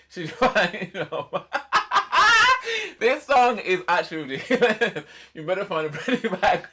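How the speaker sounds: high, loud, helpless laughter through the words; laughing. Real, not acted. Finding the song ridiculous, laughing while talking. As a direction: try to read on while cracking up. Cracks up reading ridiculous lyrics.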